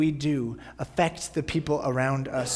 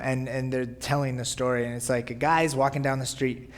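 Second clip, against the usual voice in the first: usual speaking voice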